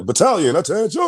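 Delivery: calling to attention. Retold or imitated. Army caller to the graduating company, mock command shout. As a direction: call it out as a military order. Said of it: "they called us to attention".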